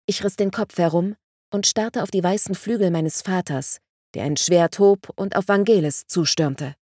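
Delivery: ordinary delivery, nothing marked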